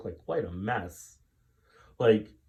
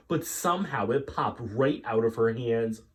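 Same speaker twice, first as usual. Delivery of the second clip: ordinary delivery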